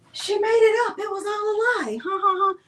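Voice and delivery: teasing herself in a high, quick voice; high mocking taunt. Crafter mocking herself as a taunting voice, high and quick. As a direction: sing out a mocking jeer.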